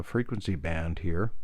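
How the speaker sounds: usual speaking voice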